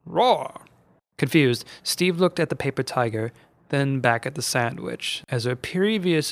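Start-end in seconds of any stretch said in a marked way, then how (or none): 0.0-0.6 a half-hearted, unimpressive roar; flat roar. A narrator's reading. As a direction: roar without any menace. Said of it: "a loud but flat sounding roar".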